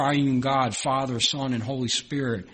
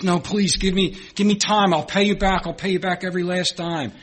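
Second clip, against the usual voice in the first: high, louder pleading for more time; begging debtor; 6 semitones higher, 4 dB louder than usual. Acted. The poor debtor begs the forgiven man, high and pleading. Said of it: "The man says".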